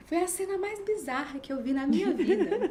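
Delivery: high, loud, incredulous retelling; retold astonishment. Friend describing a bizarre scene at young mother's house, astonished. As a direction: exclaim it as a friend's comic disbelief.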